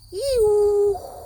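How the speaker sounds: very high, loud drawn-out whine; jet noise. A narrator's reading. Reading to children, loud high jet whine. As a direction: roar it overhead like a jet. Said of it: "A jet goes".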